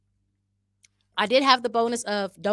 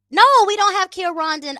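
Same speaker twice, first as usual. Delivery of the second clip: emphatic high exclamation; 12 semitones higher, 9 dB louder than usual. Real, not acted.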